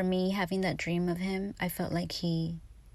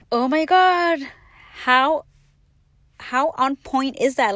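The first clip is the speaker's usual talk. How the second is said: much louder and higher, astonished; amazed reaction; 6 semitones higher, 10 dB louder, slower than usual. Retold or imitated. Reading her Aries horoscope, loud and astonished. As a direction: gasp it out, truly floored. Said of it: "I get curious and I look".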